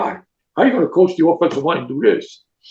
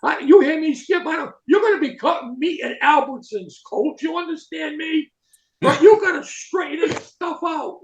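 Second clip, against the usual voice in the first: high, heated coach rant; a retold coach's tirade, pitched high; mock coach chewing out; 9 semitones higher than usual. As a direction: bark at the player like a hard-nosed coach.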